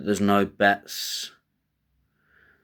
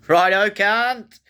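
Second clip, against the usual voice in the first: much higher, louder, slow drawn-out Australian greeting; mock Aussie; 11 semitones higher, 5 dB louder, slower than usual. Host greeting listeners in a loud, hammed-up Australian voice. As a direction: belt it out like a rowdy Aussie mate.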